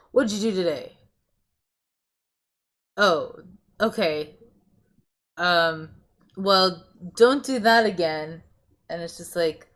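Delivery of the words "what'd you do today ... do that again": louder, curt demanding questions; mock interrogation, louder and pushier; bossy orders. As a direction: bark orders at your partner. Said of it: "more like orders".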